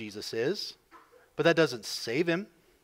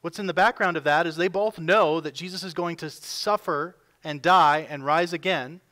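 ordinary talk, nothing marked

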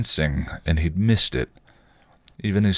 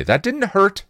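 much higher, a bit louder accusation; accusing; 3 dB louder than usual. A narrator's reading. Buffy accusing Spike of holding back his punches. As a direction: call him out, suspicious. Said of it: "she accused".

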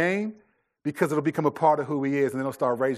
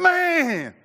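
high, loud frustrated yell; son's rage cry; 14 semitones higher, 7 dB louder than usual. Retold or imitated. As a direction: blurt out the kid's frustration. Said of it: "Then he'd play again".

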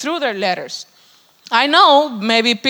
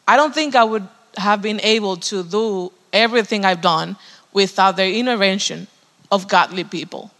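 normal talking voice

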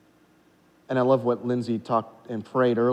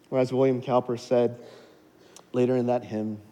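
usual speaking voice

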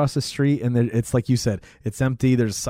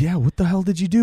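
alarmed; 7 semitones higher, 4 dB louder, faster than usual. Retold or imitated. Guy shocked at the murder.

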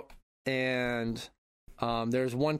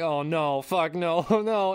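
dismayed groan; 4 semitones higher, 5 dB louder, much slower than usual. Retold or imitated. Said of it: "I'm like".

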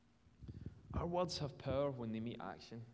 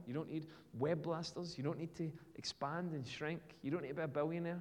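ordinary talk, nothing marked

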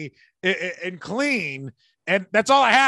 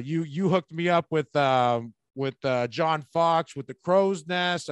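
usual speaking voice

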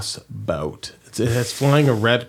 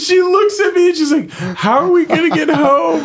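high, loud laugh through the words; laughing loudly through the lost-in-Amsterdam part; laughing hard; 5 dB louder than usual. Real, not acted. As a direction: shout it while cracking up. Laughing panic while retelling.

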